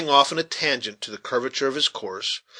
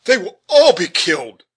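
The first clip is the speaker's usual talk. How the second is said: high, loud cry of dread; horrified judge's raised-pitch outcry; alarmed exclamation; 7 semitones higher, 8 dB louder than usual. A narrator's reading. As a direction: cry out fearing disaster. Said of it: "exclaimed the judge".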